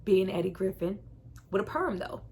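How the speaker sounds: normal talking voice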